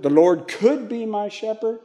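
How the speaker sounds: could pitched high, rest held up; stress on could. Shown as a demonstration. Teacher rules out maybe, stress on could. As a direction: lift could as a mere possibility. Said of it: "It's not saying".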